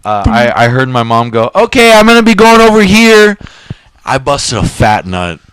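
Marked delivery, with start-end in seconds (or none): 1.5-3.4 high, louder imitation of mom. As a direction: play his mom calling out. Mom's voice in story.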